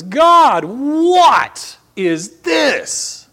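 a stretched anguished cry upward; David calling out to God, loud and slow. Retold or imitated. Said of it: "calls out and says".

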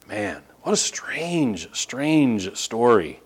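slow, hushed whisper. Real, not acted. Preacher musing to the congregation, slow hushed whisper. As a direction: breathe it out in wonder. Sermon aside, reflective.

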